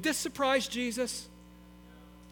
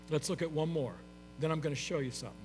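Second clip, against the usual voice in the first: ordinary talk, nothing marked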